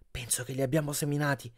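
mildly puffed after running; out of breath. A narrator's reading. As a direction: say it catching your breath. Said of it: "con il fiato grosso".

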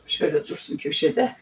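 under the breath to herself; whispered. Real, not acted. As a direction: whisper it aside while working. Muttering while arranging things. (fısıltıyla kendi kendine)

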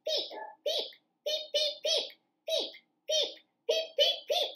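high soft little peeps; chick peeps. Acted. Reading to kids, chicks peeping high and soft. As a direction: peep like a tiny chick.

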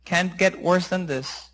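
soft, low resolve; resigned self-talk. Acted. Master talking himself into obeying, soft and resigned. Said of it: "he said to himself".

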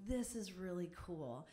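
soft awed hush under the breath; awed whisper. Retold or imitated. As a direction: whisper it in wonder at the tree. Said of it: "where you're like".

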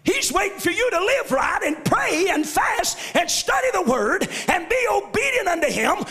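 shouted gospel preaching with rising fervor; full-force preaching. Acted. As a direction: lift the church with each line.

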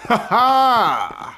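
gloating victory laugh; triumphant laugh. Real, not acted. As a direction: laugh out a win. Wins a fight in game.

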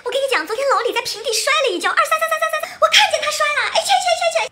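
shrill laughing squeal; high squealing excitement. Acted.

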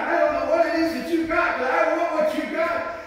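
a hint of an eager lady; quoting the lady. Lady at the door telling the preacher, lightly voiced woman.

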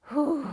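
loud, high whew of released emotion; big release exhale. Real, not acted. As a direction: blow it out after nearly crying. Emotional, about to cry.

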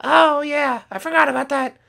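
put-on very high kid character voice; Skittles voice. Skittles explaining his crash to Dad, very high kid voice. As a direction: chirp it like an eager young son.

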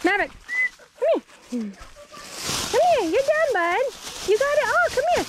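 very high, loud coaxing call; sing-song calling the dog in. Real, not acted. Calling dog back after run.

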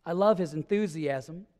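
ordinary talk, nothing marked

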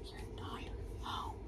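hushed whisper, slowing down. Acted. Teacher whispering to the class to pretend nobody is home. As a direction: whisper it like hiding from the monster.

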